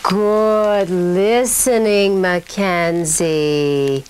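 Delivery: slow, smooth praise; easy, slow speech. Shown as a demonstration. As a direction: draw it out calmly and relaxed. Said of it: "working on easy, relaxed speech".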